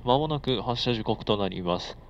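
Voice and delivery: normal talking voice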